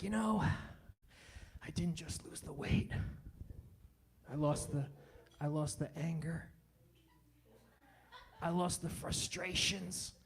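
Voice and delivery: slow, soft, low self-help guru; calm and preachy like a guru; fitness guru voice. Retold or imitated. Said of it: "tries to become this fitness guru".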